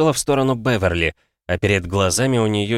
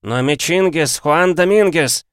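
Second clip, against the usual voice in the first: high-pitched, cheerful croaker; croaking voice; 8 semitones higher, 4 dB louder, slower than usual. A narrator's reading. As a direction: croak it out high, stretching the name. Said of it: "донёсся квакающий голос". (высокий квакающий голос)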